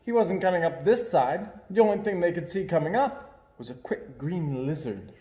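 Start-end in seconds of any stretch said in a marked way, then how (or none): none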